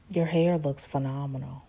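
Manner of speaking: low, soft creepy come-on. Retold or imitated. Recapper voices Stan admiring Lisa's hair, creepy. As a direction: imitate a creep flirting quietly. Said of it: "He's really creepy".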